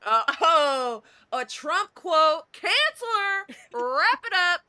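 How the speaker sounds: loud, high, drawn-out pronouncement; mock alarm. Host jokingly canceling guest over a Trump quote, mock alarm. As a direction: proclaim playful outrage, stretching the words.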